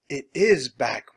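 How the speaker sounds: usual speaking voice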